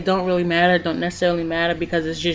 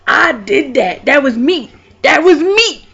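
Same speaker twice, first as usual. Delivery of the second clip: loud, higher bragging, slow and swaggering; proud boasting; 5 semitones higher, 7 dB louder, slower than usual. Retold or imitated. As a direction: crow about it like you won. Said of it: "so it made me feel like".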